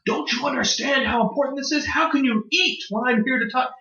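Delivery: mock a self-important director's outburst; mock screaming tantrum. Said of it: "starts screaming".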